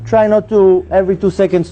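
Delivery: normal talking voice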